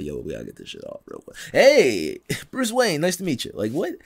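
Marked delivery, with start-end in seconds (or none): 0.0-1.4 hurried mutter. Retold or imitated. Batman scrubbing off eyeshadow. 1.5-3.5 a bright, higher, too-casual hello; a hint of Bruce Wayne charming a stranger; mock smooth greeting. Batman greeting, mask off.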